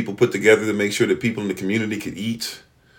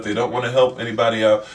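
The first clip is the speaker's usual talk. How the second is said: usual speaking voice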